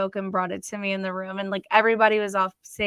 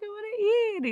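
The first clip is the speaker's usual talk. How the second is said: high, whiny mock-pleading voice; whiny complaint; 13 semitones higher, 3 dB quieter than usual. Wife mocking her own whiny plea to husband, high and whiny. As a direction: whine it like a starving, put-out wife.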